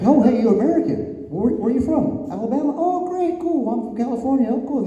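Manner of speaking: high-pitched, hammed-up small talk; mock friendly greeting. Americans from different states meeting in Japan, mock friendly greeting. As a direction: imitate chummy strangers in a high voice.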